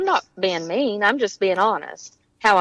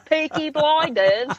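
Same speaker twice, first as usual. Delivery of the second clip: slow, higher mock-British accent; attempted British; 6 semitones higher, slower than usual. Fan naming a favorite show, attempted British accent. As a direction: drag it out in an amateur British accent. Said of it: "I try to be British".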